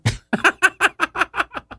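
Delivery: sudden high-pitched laugh; high burst of laughter. Real, not acted. Amused by the AI's reply.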